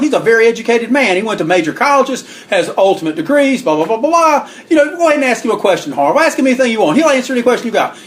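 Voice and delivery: high-pitched fast-talking heel manager; imitated wrestler's pitch, high and eager; Rip Hawk boasting. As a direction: brag up your partner in a high, insistent voice. Rip Hawk boasts for his partner.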